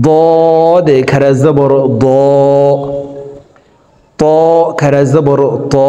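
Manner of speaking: slow, careful drill for students to repeat; teacher's call-and-repeat letter drill; slow drill recitation. Shown as a demonstration. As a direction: spell out each letter and vowel slowly. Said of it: "আমার সঙ্গে বলার জন্য চেষ্টা করুন".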